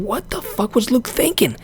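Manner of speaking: high incredulous questions; a fan's stunned tweet; retold outburst. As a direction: plead in disbelief. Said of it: "I said".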